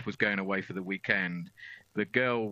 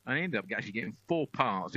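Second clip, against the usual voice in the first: normal talking voice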